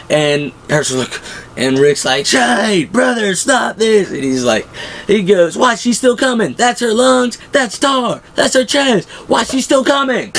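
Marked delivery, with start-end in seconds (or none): 2.3-4.1 high, slow pleading shout; mock shout. Rick pleading with Shane, high mock shout. 5.6-10.3 high, insistent yelling; mock yell. Shane proving walker is dead, high mock yell.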